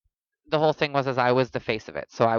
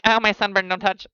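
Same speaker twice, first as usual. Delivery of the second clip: high, louder hammed-up wince; mock pained protest; 7 semitones higher, 5 dB louder than usual. Sunburned friend warding off touch, pained mock protest. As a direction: fend off a touch in mock pain.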